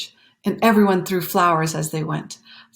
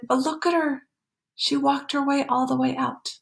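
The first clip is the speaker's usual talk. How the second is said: excited; 7 semitones higher than usual. Real, not acted. Excited aside to child.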